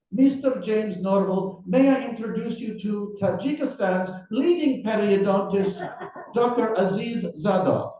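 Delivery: put-on high, formal introduction in Russian accent. Interrogator introducing the periodontist to his captive, Russian accent. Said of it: "your Russian accent".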